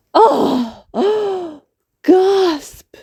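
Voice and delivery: sharp surprised high gasp; gasp of discovery. Acted. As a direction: gasp as it dawns on her. Amelia realises the truth.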